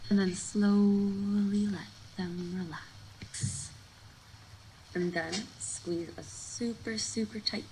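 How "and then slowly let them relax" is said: "slowly" stretched long and louder, easing off into "relax"; slowly drawn out. Real, not acted. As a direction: draw out "slowly" to match the release. Cue to release tensed forearms.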